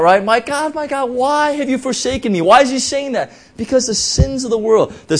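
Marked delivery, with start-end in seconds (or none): 0.2-2.4 high cry. Retold or imitated. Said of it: "and he cries out".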